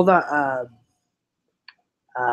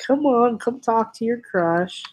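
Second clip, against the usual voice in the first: high, teasing singsong plea; playful coaxing; 8 semitones higher, slower than usual. Retold or imitated. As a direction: coax a shy friend in a raised voice. Retells coaxing a giggling friend.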